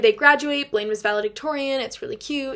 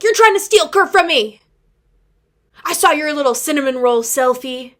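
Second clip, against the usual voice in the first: higher, louder jealous outburst; jealous accusation; 4 semitones higher, 10 dB louder than usual. Retold or imitated. Blaine accusing Kurt's friend, hammed-up jealous yell. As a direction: storm in and accuse him.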